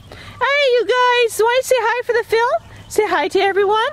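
high, bright call to the goats. Real, not acted. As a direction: sing out a high greeting to the animals. Calling to the goats.

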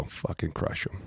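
hushed, conspiratorial whisper. Retold or imitated. Player whispering to the coach to crush the rookie, hushed. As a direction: whisper it like a secret order. Telling coach to punish Willie.

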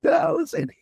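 imitating a very high, cracked rasp; beyond raspy. Actor recalling his voice after screaming all day, mock raspy. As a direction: squeak it out on a wrecked voice. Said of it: "oh, beyond raspy".

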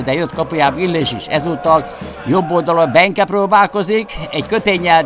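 ordinary talk, nothing marked

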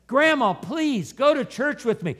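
high, loud acted-out plea; imitating an insistent granddaughter's plea; begging.

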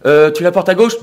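loud, high mimicry of a partner; questioning partner. Partner quizzing him about his earring, loud high mimicry. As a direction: pitch it up into a pointed question. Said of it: "un jour, elle me dit".

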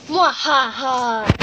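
slow evil cackle; villain laugh. Acted. Villain Bowser gloats.

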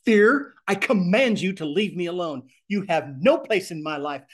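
builds to a loud shouted Fear, then firm orders; building command. Shown as a demonstration. Teacher modeling how people rebuke fear. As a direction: rise into a shout on the name. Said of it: "you get people start talking like this".

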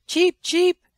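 high, loud chirping cheep cheep; squeaky baby chick call. Acted. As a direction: peep like a tiny chick. Chick voice transforming again.

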